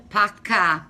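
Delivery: park said Boston-style without the R, as Paka; Boston accent. Shown as a demonstration. Woman showing viewers her dropped R's, Boston accent Paka. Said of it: "That's how I say it".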